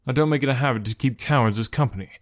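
usual speaking voice

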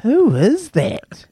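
high, slow, stunned "who is that"; surprised who-is-that. Retold or imitated. Seeing Louis with a mullet, mock stunned and high. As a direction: gawk at the young man in the members' box. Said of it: "I was like".

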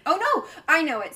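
high, loud groan of frustration; frustrated outcry. Real, not acted. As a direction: cry out in quiz frustration. Quiz answer slipping away, frustrated.

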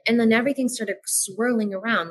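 normal talking voice

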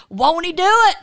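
excited. Real, not acted. Exclaiming God's goodness.

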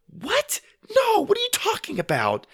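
put-on very high, indignant girl's voice; high-pitched flustered denial; baffled denial. A narrator's reading. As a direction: protest in disbelief. Sister denies alarming accusation.

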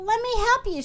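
high, keen offer of help; eager cry. A narrator's reading. Little Miss Helpful to bandaged Mister Tall, eager cry. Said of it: "she cried".

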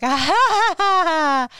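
high, put-on ha ha ha laugh; a fake, sing-song laugh brushing it off; mock laugh. [name] answers her friend with a high mock laugh.

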